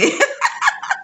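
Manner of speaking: a very high, rising laugh. Real, not acted. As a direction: laugh at how many phones you ruined. Laughing about broken phones. (tawa melengking naik)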